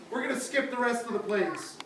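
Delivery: ordinary delivery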